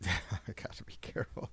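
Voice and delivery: breathy half-laughed whisper; a whispered aside mid-rant; laughing whisper. Real, not acted. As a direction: say it under your breath with a giggle.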